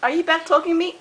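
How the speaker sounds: leaping up high in indignant surprise; scolded by her parrot, she squeaks in mock outrage. Real, not acted. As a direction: act shocked at being sassed. Bird talks back mid-scolding.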